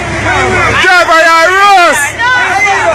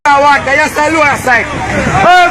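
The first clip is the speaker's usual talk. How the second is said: ordinary delivery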